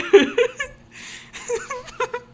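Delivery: wheezing high laugh; squealing laughter breaks through; helpless high cackle. Real, not acted. Laughing fit mid-rant.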